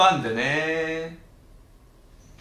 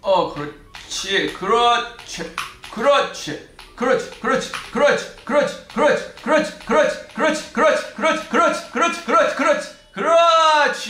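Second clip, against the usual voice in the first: rising, ever higher cheer of yes yes; excited cheering; 12 semitones higher, 5 dB louder than usual. Real, not acted. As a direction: cheer yourself on, building up. Cheering own jump run.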